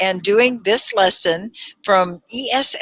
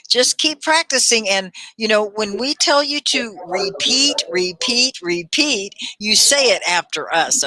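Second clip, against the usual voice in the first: ordinary talk, nothing marked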